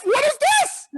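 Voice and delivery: high, loud outraged shout. Retold or imitated. Complaining passenger, outraged at seatbelt fees, shouts. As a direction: yell it in disbelief at the price.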